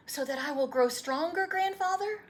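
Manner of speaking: put-on an eager young boy begging for the story; boy's voice. Boy asks.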